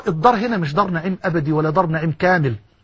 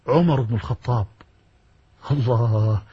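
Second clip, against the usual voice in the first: much lower and softer, reverent; hushed reveal; 9 semitones lower, 5 dB quieter than usual. Real, not acted. Preacher revealing the name to the crowd, hushed awe. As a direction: drop it to an awed hush.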